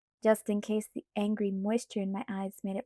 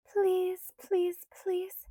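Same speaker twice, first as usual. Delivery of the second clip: pleading whisper; 9 semitones higher, much slower than usual. A narrator's reading. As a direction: beg in a small high voice. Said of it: "she whispered".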